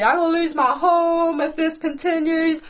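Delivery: high, loud self-pitying whinge; whiny pity party. Acted. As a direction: wail it like a hammed-up worrier. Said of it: "sit in a negative pity party".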